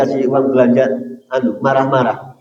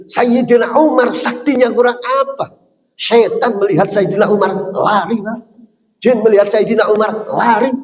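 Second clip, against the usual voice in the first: raised, higher-pitched preaching voice; impassioned preaching; 7 semitones higher than usual. Acted. Preacher scolding husbands in the congregation, raised and higher. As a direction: press the point hard on the husbands.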